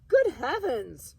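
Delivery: high, loud, slow shriek of alarm. Acted. Aunt shrieking at the clock bird, loud and high. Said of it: "shrieked Aunt Gertrude".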